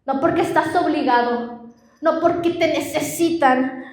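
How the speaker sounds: normal talking voice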